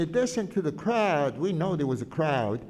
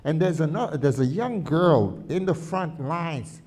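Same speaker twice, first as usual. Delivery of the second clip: ordinary delivery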